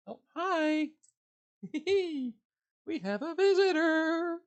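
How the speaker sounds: excited pet greeting. Real, not acted. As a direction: coo at the surprise visitor. Cat climbs onto streamer.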